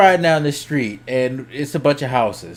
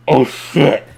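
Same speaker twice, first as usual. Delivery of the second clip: louder startled gasp of a phrase; caught-out oh shit; 5 dB louder than usual. Retold or imitated. Teen realizing he cursed before his mom, startled. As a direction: realize you slipped in front of mom. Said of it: "of course I'm like".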